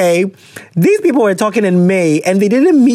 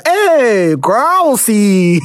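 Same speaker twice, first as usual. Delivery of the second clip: teasing exclaim; 2 semitones higher, much slower than usual. Real, not acted. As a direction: tease her with a rising call.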